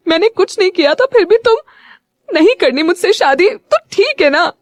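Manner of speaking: high, tearful reproach, pitch jumping up. A narrator's reading. Vishu crying at Yuvi for rejecting marriage, high tearful. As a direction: plead through tears, voice climbing high. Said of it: "Vishu रोते हुए बोली".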